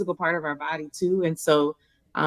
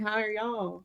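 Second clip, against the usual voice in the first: Southern accent drawled quietly; 5 semitones higher, 7 dB quieter than usual. Shown as a demonstration. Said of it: "I know my Southern accent saying".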